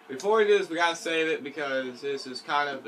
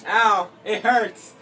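high, loud cry with some laughter; pained shout; 6 semitones higher, 11 dB louder, much slower than usual. Real, not acted. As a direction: cry out in pain, half laughing. Reacting to getting hurt.

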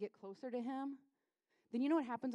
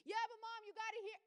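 put-on high-pitched insistent kid voice; yelling son; 9 semitones higher, 5 dB quieter than usual. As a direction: imitate a child pestering mom. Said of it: "stop yelling at me".

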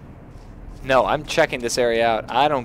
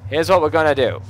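louder, higher, decisive; punched-up second take; emphatic plan announcement; 4 semitones higher, 5 dB louder, faster than usual. Real, not acted. Restates plan with force.

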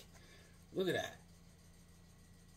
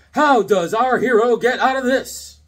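slow, loud announcer voice; dramatic cover blurb; 6 semitones higher, 6 dB louder than usual. Acted. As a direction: tease the cliffhanger.

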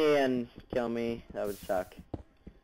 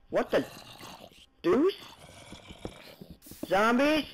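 surprised; 10 semitones higher, slower than usual. Real, not acted. Surprised by zombies.

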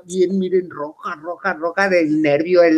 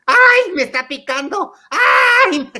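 high, loud mock yelp of pain; hammed-up bride's yelp; pained shriek; 5 dB louder, slower than usual. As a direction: squeal as the claws dig in. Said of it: "le gritaba a la otra".

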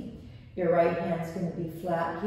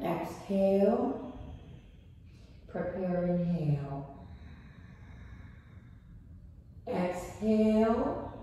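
breathing cues. Acted. Paced breathing cues in yoga.

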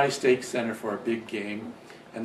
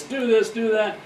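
raised, high-pitched mock coach yell; retold coach's screaming; 10 semitones higher, 6 dB louder than usual. Coach running the sideline at his players, mock screaming. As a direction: imitate a coach hollering orders, higher and louder. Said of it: "screaming at him and all this".

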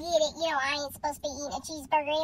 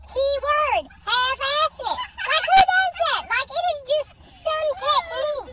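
indignant rant; 7 semitones higher, 4 dB louder than usual. Real, not acted. Ranting about the half-and-half bun.

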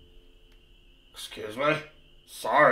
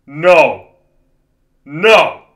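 frightened yelling; 7 dB louder than usual. Real, not acted. Scared at monster.

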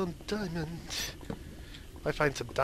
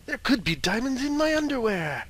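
silly joke; 9 semitones higher, 7 dB louder than usual. Pretend.

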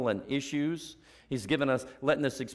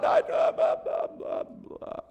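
mock blah blah; slower than usual.

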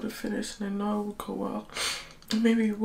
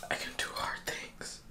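real teary whisper; 9 dB quieter than usual. Real, not acted. Filming herself crying before dawn, teary whisper. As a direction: keep it a secret whisper, barely voiced. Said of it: "I don't know why I'm whispering".

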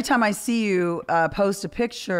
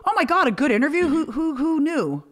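high, laughing mock surprise; mock amazement; 9 semitones higher than usual. Host imitates the press raving, high-pitched and laughing. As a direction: gush it like the world just discovered good interviews. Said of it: "But they're like".

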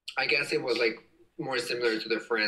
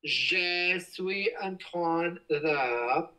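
slow, searching French; hesitant, feeling for words; halting French; 4 semitones higher, much slower than usual. Real, not acted. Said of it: "How do you say embarrassing in French?".

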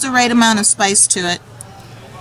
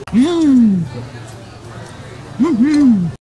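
satisfied mm of tasting; 4 semitones higher than usual. Real, not acted. Tasting food.

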